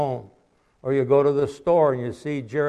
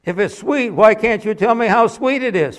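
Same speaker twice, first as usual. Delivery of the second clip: higher voice for the curious man; the man asking; 8 semitones higher, 6 dB louder than usual. Retold or imitated. Preacher voicing a man asking a boy. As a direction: voice the stranger questioning the boy.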